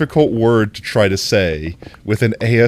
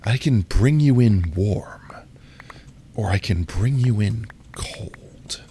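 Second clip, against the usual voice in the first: slow, breathy menacing whisper; stern sexy whisper; slower than usual. Shown as a demonstration. Second take for Potato, slow stern sexy whisper. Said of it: "a stern sexy".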